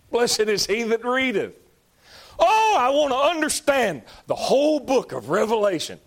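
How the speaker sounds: high, loud preacher's cry on "Blessed"; fervent preaching. Real, not acted. Preacher pressing the verse on his congregation, loud and high.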